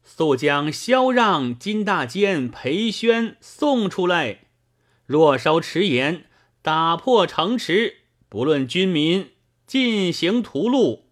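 high-pitched call up to the city. A narrator's reading. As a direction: pitch it high, proclaiming an ultimatum. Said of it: "往城中高叫道". (高声喊话)

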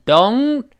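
don't with a long diphthong; stretched model don't. Shown as a demonstration. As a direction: glide from oh into n in don't. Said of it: "o再加前鼻音".

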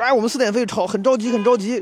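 high urgent voice of a pushy stranger; pushy man. Retold or imitated. Pushy stranger urging the queue to let him through. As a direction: whine that you're in a hurry.

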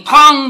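sudden loud "bang"; narrator's punchy impact noise; loud thud imitation. A narrator's reading. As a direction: burst out the sound of a heavy blow. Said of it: "的一声".

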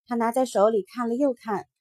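ordinary delivery

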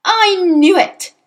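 high, loud, slow bellow. A narrator's reading. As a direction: bellow it in triumph. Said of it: "she bellowed".

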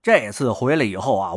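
plain reading voice

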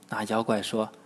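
ordinary delivery

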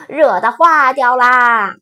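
loud excited yell; loud shout. A narrator's reading. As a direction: call it out loud. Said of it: "弗洛格大声叫道".

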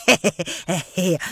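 hehehe, an ingratiating little laugh; fawning chuckle. Acted. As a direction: laugh to curry favour. 胡正勇讨好地笑. (谄媚地嘿嘿笑)

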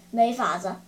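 low, sighed-out admission; acted weary resignation; resigned. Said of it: "接着他叹了口气".

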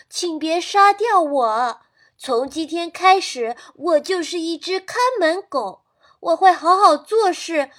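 a rooster promising to be a guard dog; urgent pleading. Acted. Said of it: "急切的叫声". (急切地求饶)

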